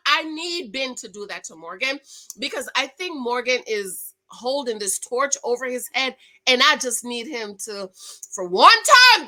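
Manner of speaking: ordinary delivery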